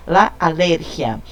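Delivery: "La alergia" slow, stress on the ER syllable; Spanish stress. Shown as a demonstration. Teacher repeating "La alergia" slowly for the class. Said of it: "eu digo la alergia".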